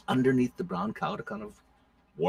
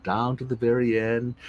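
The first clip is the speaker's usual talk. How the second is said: usual speaking voice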